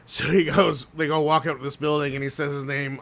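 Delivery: ordinary talk, nothing marked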